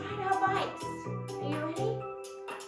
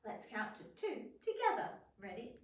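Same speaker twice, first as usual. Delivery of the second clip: kindergarten teacher voice; 2 semitones lower, 5 dB quieter than usual. Acted. Host addresses children.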